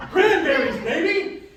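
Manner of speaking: high, full-voiced rallying shout; enthusiasm. Shown as a demonstration. Host leads the audience in a second try, shouted with enthusiasm. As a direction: rouse the crowd with a big cheer. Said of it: "a little bit of enthusiasm".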